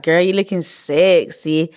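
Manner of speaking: slow drawn-out compliment; boyfriend sweet-talk.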